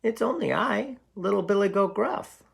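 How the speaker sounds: little goat answering the troll; tiny goat. Acted. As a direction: squeak it up like the smallest goat. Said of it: "said the goat".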